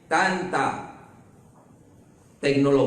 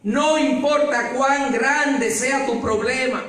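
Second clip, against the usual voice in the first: higher, louder, slow fervent preaching; preaching at full force; 11 semitones higher, 4 dB louder, slower than usual. Acted. Preacher urging the church that God's voice restores life, fervent preaching. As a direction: drive the point home to the congregation. Sermon climax to congregation.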